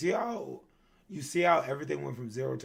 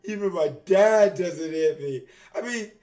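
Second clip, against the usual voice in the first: much higher, laughing whine; whiny laughing victim; 14 semitones higher, 8 dB louder than usual. Acted. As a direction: squeal it like a bruised brat. Character whining after being hit.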